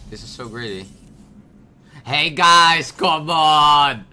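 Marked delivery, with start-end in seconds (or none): 2.0-4.1 loud, high exasperated cry; frustrated shout. Real, not acted. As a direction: yell at bad luck. Frustrated outcry.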